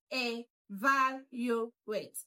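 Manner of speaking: "evaluate" slowly, syllable by syllable; broken into syllables. Shown as a demonstration. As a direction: break "evaluate" into e-VAL-u-ate with gaps. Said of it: "let's break it down".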